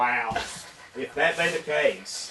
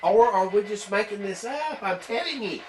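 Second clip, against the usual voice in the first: excited; 8 semitones higher than usual. Real, not acted. Spirit box seems to answer again.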